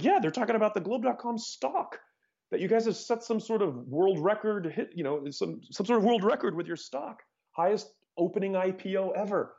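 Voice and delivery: imitating callers breaking big news, pitch high; excited caller. Founder voicing callers about the record stock debut, excited.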